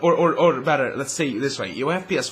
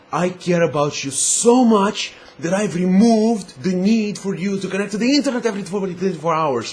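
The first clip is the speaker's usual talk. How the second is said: sugary mock declaration of love, louder and higher; mock loving; 4 semitones higher, 4 dB louder than usual. Gamer mocks Microsoft's fake love for customers, syrupy and louder. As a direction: gush over the customers in fake adoration.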